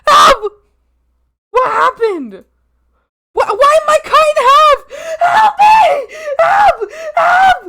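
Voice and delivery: high, loud cries for help; mock-panicked cries. Streamer finds themselves cut in half in game, mock panic. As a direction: yelp in hammed-up alarm.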